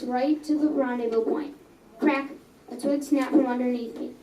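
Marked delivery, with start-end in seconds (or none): none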